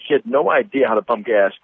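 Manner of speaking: ordinary delivery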